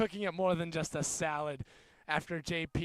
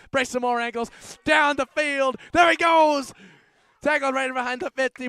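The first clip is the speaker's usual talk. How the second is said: higher and louder as the runner breaks free; excited commentary yell; 9 semitones higher, 13 dB louder than usual. Acted. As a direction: roar as he breaks away down the field. Big run in commentary.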